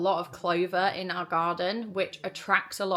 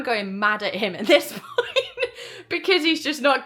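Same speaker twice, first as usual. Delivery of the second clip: laughing exasperation; 5 semitones higher, 7 dB louder than usual. Real, not acted. Recalling being mad, breaks into laugh.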